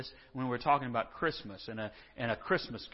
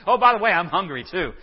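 much louder, playful ask; cheeky loud afterthought; 4 semitones higher, 11 dB louder than usual. Retold or imitated. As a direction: blurt out the extra request. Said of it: "So he says".

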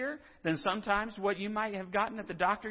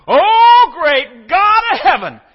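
booming, much higher, much louder revival shout; over-the-top preaching; 9 semitones higher, 21 dB louder than usual. Pretend. Preacher hamming up a revival healer's booming call. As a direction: belt it like a faith healer at full tilt. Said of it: "That's how I'd preach if y'all let me".

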